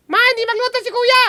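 put-on high tattling kid; child voice. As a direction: whine like a little kid. Host voices child in skit.

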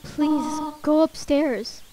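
lightly voiced gentle goat mother, soft and pleading; a hint of a sad motherly plea; Toriel voice. Mother figure begging the child to go upstairs, softly.

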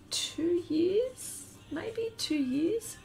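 sheepish whisper. Real, not acted. Admitting time spent in shed.

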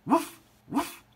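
two high, short woofs; dog barks. A narrator's reading. Ebb rowing to Seal Island, high barking. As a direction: yap twice like a little dog. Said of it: "barked Ebb".

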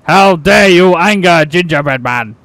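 loud, indignant cartoon character voice; angry gingerbread man. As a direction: bellow in hammed-up outrage. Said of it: "The gingerbread man is not happy".

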